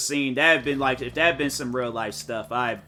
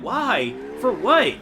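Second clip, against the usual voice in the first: outraged why; 5 semitones higher, 4 dB louder, slower than usual. Retold or imitated. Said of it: "I'm like".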